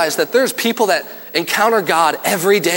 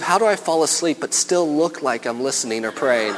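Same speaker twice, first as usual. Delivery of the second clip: quiet, lower mumbled thought; mock sleepy churchgoer; 6 semitones lower, 6 dB quieter than usual. Pastor voicing a bored teen at church, quietly. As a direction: muse it under your breath like a bored teen. Chair three person's inner thought.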